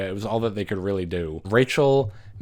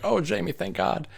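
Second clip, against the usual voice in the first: mock relieved Rachel; 10 semitones higher than usual. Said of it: "And then Rachel's like".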